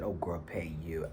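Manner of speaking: hushed, slow whisper. Retold or imitated. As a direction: drop to a stunned whisper.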